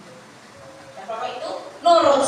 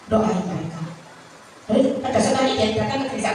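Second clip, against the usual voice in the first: ordinary talk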